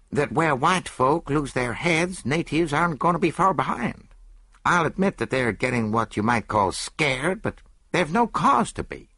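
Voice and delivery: lightly voiced American; a hint of American doctor. Ames answers.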